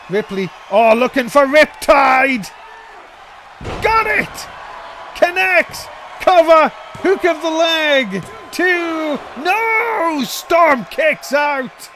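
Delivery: screaming near-fall call; wrestling commentator. Real, not acted. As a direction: yell the count, high and loud. Near fall called live.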